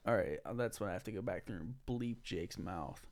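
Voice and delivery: hushed whispered aside; whispering. Real, not acted. Aside about bleeping, whispered.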